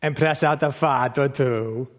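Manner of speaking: slow, drawn-out mock Italian accent. Shown as a demonstration. Dad doing his joke line to make Rachel laugh, Italian accent. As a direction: put on a playful Italian accent, stretching the words. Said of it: "you say it with an Italian accent".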